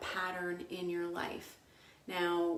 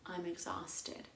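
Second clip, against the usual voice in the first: soft, sighed-out complaint, mildly weary; weary exclamation; 2 semitones lower, 10 dB quieter than usual. Retold or imitated. Woman quoting her own habitual self-talk, soft and mildly weary. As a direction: let it drop away softly, lightly imitating tiredness. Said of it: "to say".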